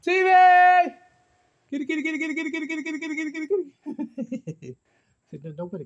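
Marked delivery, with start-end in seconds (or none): 0.0-0.9 loud, high call across the yard. Acted. Vlogger calling out names to viewers, loud and high. 1.7-4.8 high sing-song kitty call. Acted. Vlogger calling an imagined cat, high and sing-song.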